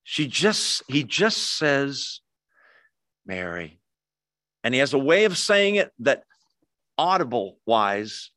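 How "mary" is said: low, soft, tender name; hushed tender call; quiet and loving. Retold or imitated. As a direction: speak the name low and gently, with love. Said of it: "I think he says it with such love".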